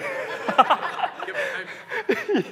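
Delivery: squeaky high laugh through tears; laughing at his own tears; high laughing squeal. Real, not acted. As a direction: let a high laugh burst out. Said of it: "I'm just weeping".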